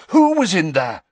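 urgent. A narrator's reading. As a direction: press her hard for the answer. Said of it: "urgency in Harry's voice".

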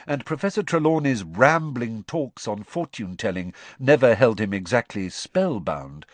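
usual speaking voice

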